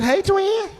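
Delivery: high sweet call of twin; lightly voiced high hello; mock sister greeting. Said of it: "She said".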